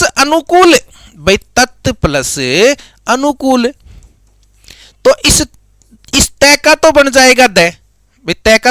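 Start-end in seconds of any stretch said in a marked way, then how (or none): none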